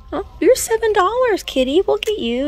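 high, loud cooing at a kitty; playful high-pitched fussing; pet talk. Pretend. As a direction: talk to a toy cat like a beloved pet. Talks to toy kitty as pet.

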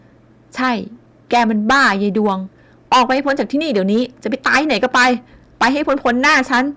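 loud, furious casting out; furious scolding. A narrator's reading. Father furiously throwing his daughter out. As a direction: drive her out of the house. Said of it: "ไล่อย่างโมโห".

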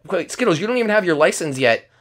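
ordinary talk, nothing marked

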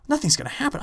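incredulous echo. Retold or imitated. Echoing her in disbelief.

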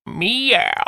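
meek cat meow for a wolf; a little kitty meow; wrong meow. Acted. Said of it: "Wolves don't say meow".

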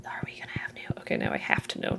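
hushed curious whisper. Real, not acted. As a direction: whisper to yourself, intrigued. Curious about new ore.

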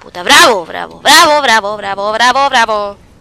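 loud repeated cheer of bravo; excited cheering. Real, not acted. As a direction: cheer each bravo loudly in triumph. Excited cheering after kill.

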